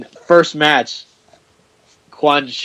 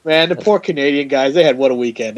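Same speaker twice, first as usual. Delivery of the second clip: usual speaking voice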